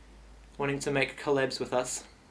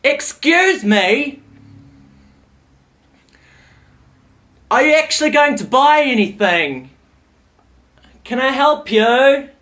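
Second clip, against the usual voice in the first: high, loud and slow, exaggerated politeness; mock courteous service voice, drawn out; overly polite; 10 semitones higher, 14 dB louder, much slower than usual. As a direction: ham up a fake-polite shop assistant. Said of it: "very polite-, politely".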